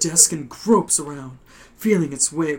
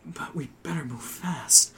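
urgent whispered warning; 7 dB quieter than usual. Acted. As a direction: whisper it hurriedly. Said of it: "you whisper back".

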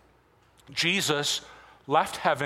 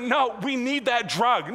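put-on higher, pleading kid voice; imitating his son; 6 semitones higher, slower than usual. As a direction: beg like a seven-year-old. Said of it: "he's like".

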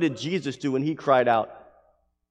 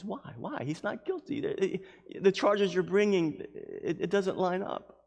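high, soft, halting protest; questioning Pilate; 7 semitones higher, 4 dB quieter than usual. Acted. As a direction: stammer out the objection as Pilate. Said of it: "Pilate questions them".

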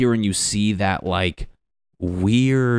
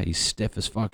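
ordinary delivery

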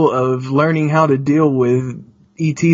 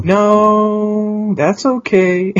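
high, held-out singsong brush-off; mock polite refusal; 7 semitones higher, much slower than usual. Stepmother turning down going to see the UFO, high singsong refusal. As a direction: decline in an imitated high, stretched voice. Quoting stepmother declining to look.

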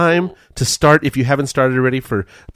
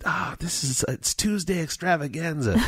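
quiet contented sigh; 3 semitones higher, 10 dB quieter than usual. Retold or imitated. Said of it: "I said".

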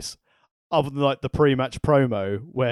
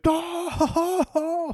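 excited chest gasps; slower than usual. Retold or imitated. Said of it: "in my chest go".

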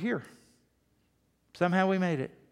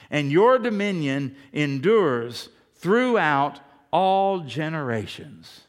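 scripture reading; 6 dB louder, slower than usual. Real, not acted.

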